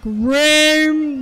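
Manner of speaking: loud, drawn-out track name; calling out. Pretend. Gamer announcing the Rainbow track, loud drawn-out call. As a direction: belt it out long.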